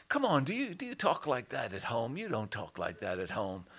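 low, quiet, unimpressed objection; sceptical critic. Acted. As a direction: murmur a doubting challenge. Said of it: "have criticism towards that and say".

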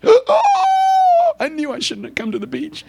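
high-pitched moan of regret; comic wail. Acted. Believer regretting the beach trip, comic wail. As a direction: wail it in mock dismay. Comic dismay at God's errand.

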